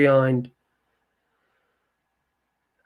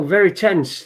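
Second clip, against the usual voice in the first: ordinary talk, nothing marked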